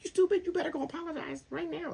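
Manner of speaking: very high-pitched mock wife voice; scolding wife. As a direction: imitate an angry wife in falsetto. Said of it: "his wife was probably like".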